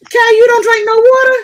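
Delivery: high, loud disbelieving question; can't believe she drinks no water; shocked. Real, not acted. Host shocked at a guest who drinks no water.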